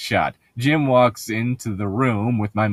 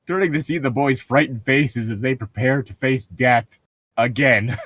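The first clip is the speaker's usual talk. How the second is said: ordinary delivery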